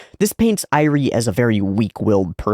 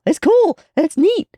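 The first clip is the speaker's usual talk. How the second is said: way higher, squeaky and tiny; squeaky mock voice; 4 dB louder than usual. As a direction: chirp it like a cartoon fan. Mock voice while watching a fight.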